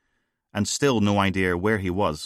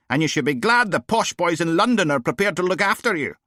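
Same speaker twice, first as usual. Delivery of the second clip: much higher, louder mocking posh Aberdonian voice; posh Aberdonian accent; 12 semitones higher, 6 dB louder than usual. Steele mocking a unionist in a posh Aberdonian accent. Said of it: "She put on a posh Aberdonian accent".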